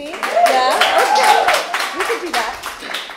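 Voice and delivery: high, bright character voice warming up; Auntie B voice. Acted. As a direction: slip into the Auntie B voice. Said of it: "In your Auntie B voice?".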